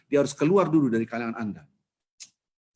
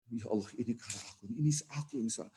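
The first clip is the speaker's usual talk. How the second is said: hushed repentance; 10 dB quieter than usual. Acted. Sinner repents, weeping at heart.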